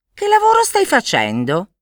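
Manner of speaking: high-pitched inquisitive bird voice; curious magpie. A narrator's reading. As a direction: ask like a nosy little magpie. Magpie questions the woodcutter. (gazza curiosa con voce acuta)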